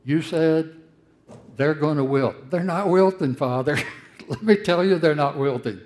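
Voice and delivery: Jeremiah's protest. Retold or imitated. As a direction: hammer home that they're not wilting. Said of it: "He says".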